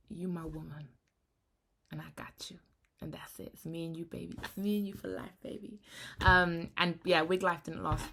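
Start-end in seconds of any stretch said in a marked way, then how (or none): 0.1-5.8 imagined partner. Retold or imitated. Imagining a partner reassuring her, softly voiced. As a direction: murmur it like a devoted partner. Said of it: "I really just want someone to be like".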